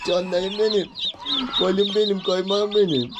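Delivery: high, tender pet talk; cooing endearments. Real, not acted. Owner cooing sweet names to his new chicks, high and tender. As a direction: coo over them like babies.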